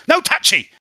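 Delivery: put-on high, loud cockney girl snapping at the radio; squeaky street urchin, loud and rude; Spring's voice. Spring griping at the truck radio, high and loud.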